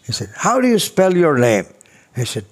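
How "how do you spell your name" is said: higher, mildly stern question; a touch of a brisk judge; imitating the judge.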